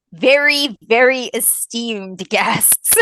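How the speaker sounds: slow, drawn-out mock reverence; mock grandeur. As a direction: savor each word with fake awe. Mock-grand praise of guests.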